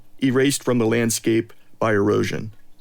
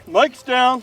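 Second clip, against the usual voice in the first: calling out; 12 semitones higher, 4 dB louder than usual. Real, not acted. Calling out as Mike falls.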